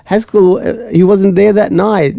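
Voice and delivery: insistent. Retold or imitated. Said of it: "I said".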